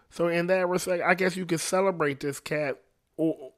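normal talking voice

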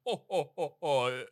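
soft, lightly voiced Santa laugh; a gentle ho ho ho; jolly Santa ho ho ho.

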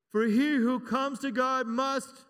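slow, loud preacher's prompt. Shown as a demonstration. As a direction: raise the voice and drag the line for them to answer. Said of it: "I wanna hear ya".

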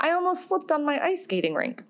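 put-on high, cheerful little-boy voice; toddler voice. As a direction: play the proud three-year-old. Quoting her toddler son.

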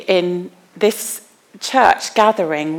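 ordinary talk

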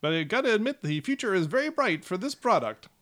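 high, eager salesman gnome; smooth it over like a huckster; high gnome salesman covering for a flop. Acted. Gnome salesman keeps selling.

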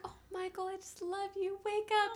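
high, soft, cooing wake-up call; sweet princess voice. Retold or imitated. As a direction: coo him awake like a fairy-tale princess. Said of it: "like a Disney princess".

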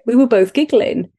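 usual speaking voice

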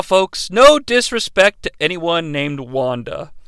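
ordinary delivery

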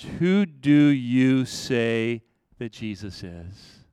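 slow and low, pressing the question; slow searching question. Acted. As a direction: ask it slowly to every listener. Preacher repeats key question slowly.

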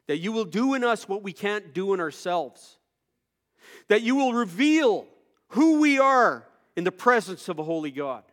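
loud, high pleading prayer; fervent prayer. Real, not acted. Pastor leading the church in pleading prayer. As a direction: beg God with your whole voice.

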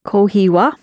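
"Kohi wa" with the wa lifted slightly. Shown as a demonstration. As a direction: let "wa" rise gently at the end of "Kohi wa". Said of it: "just slightly raise the, the wa intonation".

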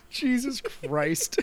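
laughing until it hurts, breathless; laughing through pain. Real, not acted. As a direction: laugh so hard it aches. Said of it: "It hurts to laugh".

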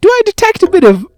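high, loud, prissy mock of the agent; high mock FBI agent. Mock agent quizzing him about his accent, high and loud. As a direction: ham up her stilted question. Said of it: "and she's like".

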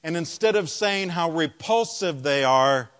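normal talking voice

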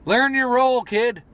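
high, loud promo taunt; wrestling taunt. Acted. Wrestler telling off absent rival, loud taunt.

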